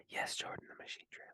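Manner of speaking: hushed, breathy whisper to the producer; playful stage whisper asking for the clip. Real, not acted. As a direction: whisper the cue like a secret. Jokingly cueing the producer.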